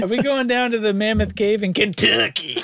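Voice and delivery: put-on high-pitched kid asking; four-year-old child. Host playing a four-year-old asking about the trip, high child voice. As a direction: pretend to be a small child on a trip. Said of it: "imagine a four-year-old saying".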